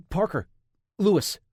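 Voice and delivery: scared captive stammering out his name. A narrator's reading. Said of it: "he stammered".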